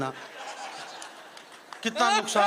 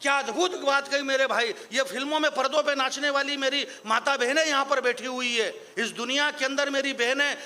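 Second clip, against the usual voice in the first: ordinary delivery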